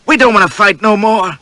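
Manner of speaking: high, loud desperate plea; frightened cry of surrender, pitched well up; pleading hostage. A narrator's reading. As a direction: beg the captain not to shoot.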